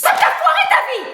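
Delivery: shrieking mother; furious mom scream. Retold or imitated. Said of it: "qui me répéterait sans cesse". (hurlement de mère furieuse)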